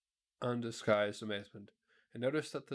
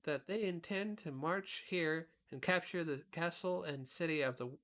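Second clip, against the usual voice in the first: put-on a boy reporting news, pitched up; boy Tip; 6 semitones higher than usual. A narrator's reading. Tip speaks.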